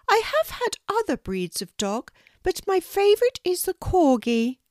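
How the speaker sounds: a kindly Queen welcoming a guest, pitched well up. Acted. The Queen inviting a shy girl to tea, high regal voice. Queen speaks about dogs.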